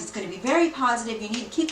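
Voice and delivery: fast, eager rush of words; speaking quickly. Real, not acted. Tarot reader rushing to share good news, speaking fast. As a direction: rattle it off with the card's energy. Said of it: "Now I'm speaking really quickly".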